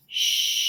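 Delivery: Shh held on one breath; Shh, long and steady; continuous Shh. Shown as a demonstration. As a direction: sustain the Shh. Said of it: "You can make it continuously".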